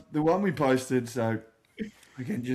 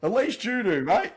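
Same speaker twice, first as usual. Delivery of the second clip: cheeky comeback; 9 semitones higher than usual. Retold or imitated. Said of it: "I've gone".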